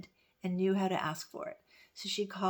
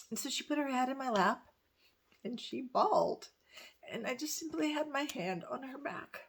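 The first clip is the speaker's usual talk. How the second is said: high voice, close to tears; touched, emotional; 5 semitones higher, 4 dB louder than usual. Real, not acted. Telling how her friend bawled in her lap, choked up. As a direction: tell it welling up, a laugh breaking through. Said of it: "That really touches me".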